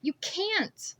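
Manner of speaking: defiant retort. A narrator's reading. Narrator defies the scarred man, heated. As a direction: snap back refusing.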